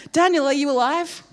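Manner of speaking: loud, high anxious call; tense call. Acted. King calling into the den again, tense and loud. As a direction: call out fearing the worst. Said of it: "Let's go back to that tense moment".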